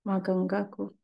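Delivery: calm, unhurried meditation guide with long pauses; guided meditation. Acted. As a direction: lead the masters gently into stillness and prayer.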